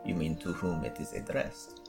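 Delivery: soft prim whisper. Acted. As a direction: whisper it like a fussy pedant. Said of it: "Light of Dawn whispers".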